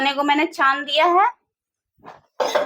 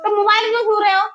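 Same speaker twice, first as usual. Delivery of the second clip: fast, loud, high-pitched reproach; scolding challenge; 9 semitones higher, 5 dB louder, faster than usual. Real, not acted. Mother catches child touching the mobile, scolding. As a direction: fire the question as a telling-off.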